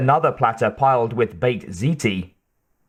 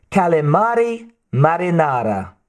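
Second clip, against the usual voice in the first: higher, hammed-up Italian accent; bad Italian accent; 5 semitones higher than usual. A narrator's reading. Angie presents the seafood platter in her best Italian accent. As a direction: announce it in a poor mock-Italian accent. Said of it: "in her best Italian accent".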